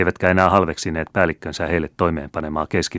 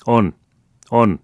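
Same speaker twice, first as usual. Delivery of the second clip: short, raised, louder replies; called-out answers; 6 semitones higher, 6 dB louder than usual. A narrator's reading. Old veterans answer the youth from several sides, called out. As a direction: answer up from the ranks. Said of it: "vastattiin usealta taholta".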